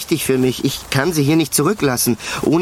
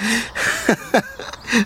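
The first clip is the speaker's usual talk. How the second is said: relieved laugh; 8 semitones higher, 4 dB quieter than usual. Acted. Relieved that she is herself again.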